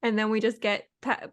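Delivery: ordinary talk, nothing marked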